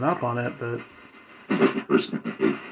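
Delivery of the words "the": deep distorted voice through static; low voice crackling through static. Real, not acted. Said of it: "that same creepy deep voice guy".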